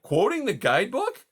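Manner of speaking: high, incredulous outburst; shocked and offended; indignant disbelief. Acted.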